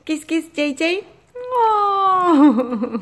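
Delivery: high cooing pet voice. Real, not acted. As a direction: coax a kiss from the pet. Asking the pet for kisses.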